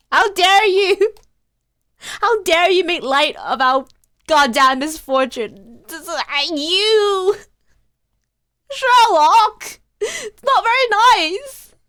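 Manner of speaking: loud, high, slow indignant shouting; furious outburst. Acted. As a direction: rage at him for mocking the misfortune. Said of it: "I'm so angry".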